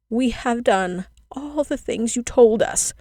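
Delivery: put-on children crying out their plea. A narrator's reading. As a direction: beg her, drawing every word out. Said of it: "the children cried again".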